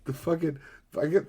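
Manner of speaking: normal talking voice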